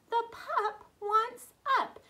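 excited teacher repeat, high and loud. Shown as a demonstration. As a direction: pitch it up excitedly for the kids. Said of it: "excited repeat of sentence".

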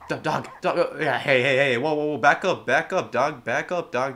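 loud yells to back the dog off; alarmed shouting. Real, not acted. Gamer shouting at a charging dog to back up.

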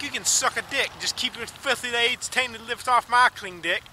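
ordinary talk, nothing marked

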